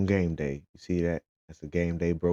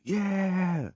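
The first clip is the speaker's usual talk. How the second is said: crowd yell; 13 semitones higher than usual. Retold or imitated. Fans cheering in line.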